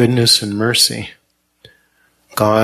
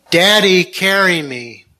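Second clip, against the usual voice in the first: almost shouting; 7 semitones higher than usual. A narrator's reading. Burned boy repeats the plea to his father, almost shouting. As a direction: call out the plea, just short of a shout. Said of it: "Almost shouting".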